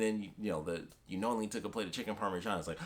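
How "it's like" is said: ordinary talk, nothing marked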